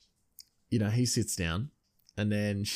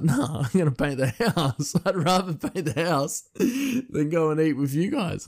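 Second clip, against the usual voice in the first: laughing through it; 7 semitones higher, 3 dB louder than usual. Retold or imitated. Aunt turning down Christmas for painting, laughing mock voice. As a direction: laugh while quoting her. Aunt's reply retold laughing.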